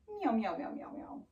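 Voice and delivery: string of meows dying into breath; cat breath meows. Shown as a demonstration. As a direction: meow repeatedly on one exhale, fading. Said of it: "Cat breath".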